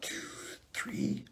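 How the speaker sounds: counting breaths, fading to a whisper; softly counting. Shown as a demonstration. Guiding listeners through breath counts, softly fading. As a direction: count slowly and softly, trailing off. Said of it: "you count in your head".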